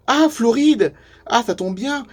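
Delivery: high, loud burst of recognition; delighted surprise. Acted. Camper hearing the newcomer is from Florida, delighted surprise. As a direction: exclaim at a happy coincidence.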